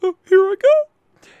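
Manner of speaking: very high, loud cartoonish squeal; mocking Alistair. Player making fun of rival's dialogue, mocking high voice. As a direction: squeal it out in a put-on falsetto. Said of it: "start reading his, like, dialogue there".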